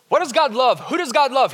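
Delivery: ordinary delivery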